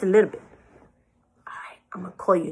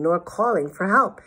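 plain reading voice

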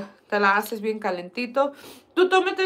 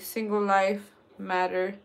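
ordinary talk, nothing marked

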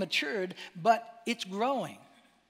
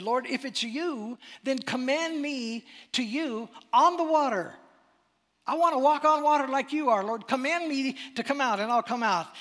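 higher and louder, pumped disciple begging; a keen disciple calling to the Lord; eager Peter; 6 semitones higher, 6 dB louder than usual. Acted. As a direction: burst with eagerness to walk on water.

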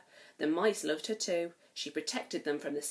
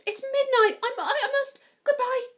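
high, loud, flustered stammer; rushing off in alarm; panicked; 8 dB louder than usual. Acted. Cinderella panics and stammers goodbye to the prince, high and loud.

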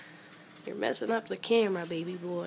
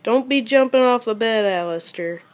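warning a pet; 3 semitones higher, 7 dB louder than usual. Real, not acted. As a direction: call out a quick warning to a pet.

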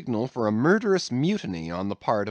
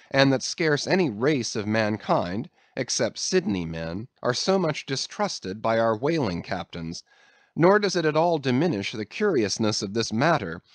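ordinary delivery

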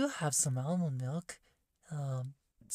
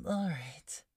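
ordinary delivery

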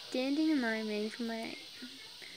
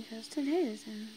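ordinary delivery

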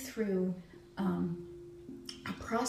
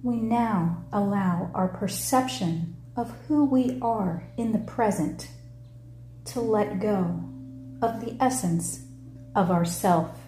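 measured meditation-leader voice, falling phrase ends; guided meditation; 10 dB louder than usual. Acted. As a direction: lead them through it in short phrases with long pauses.

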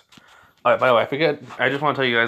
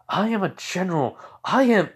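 outraged boast; 7 semitones higher than usual. Acted. Esdeath asserting her rank.

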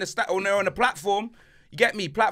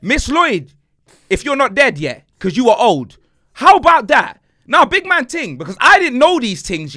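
loud call-out; 7 semitones higher, 10 dB louder than usual. Real, not acted. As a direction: shout it across at her, half joking. Calling out to old teacher.

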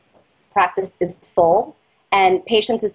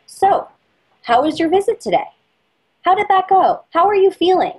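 higher-pitched cheery questions, like a friendly host; a chirpy, pitched-up fishing for praise; bright, eager dentist voice; 10 semitones higher than usual. Shown as a demonstration. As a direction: sound eager to hear their answer. Role-playing dentist fishing for compliment.

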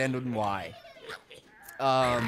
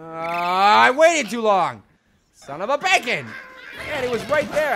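frustrated outburst; 6 semitones higher, 8 dB louder than usual. Real, not acted. Frustrated at missing shot.

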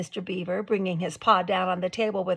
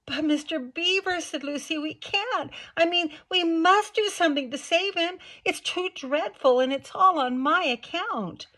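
put-on higher, louder girl's voice, desperate; young girl pleading; 10 semitones higher, 4 dB louder than usual. A narrator's reading. Lucy begging Mister Beaver to save the faun, higher and urgent. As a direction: beg for the faun's rescue.

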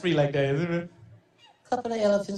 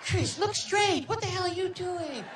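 Michael voice; 9 semitones higher than usual. Retold or imitated. Impersonating Michael scolding Chris on set, high and breathy.

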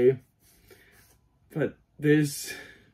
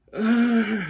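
high, loud groan of excitement; excited groan; 8 semitones higher, 4 dB louder than usual. Real, not acted. Cosplayer overcome by new cowl, excited groan. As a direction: let out a giddy groan.

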